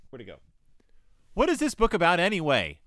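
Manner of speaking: ordinary talk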